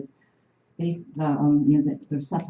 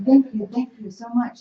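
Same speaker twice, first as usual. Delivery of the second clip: higher, gushing thanks, lightly voiced; grateful women; 7 semitones higher, 5 dB louder than usual. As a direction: mimic the grateful marchers. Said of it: "coming up to us and saying".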